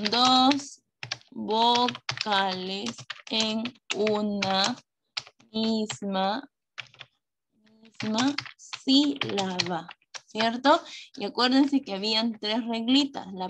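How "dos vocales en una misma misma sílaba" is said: very drawn out, word by word; slow dictation. Real, not acted. Teacher writing "a un costadito" for pupils, slow dictation. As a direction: spell it out at writing speed with long pauses.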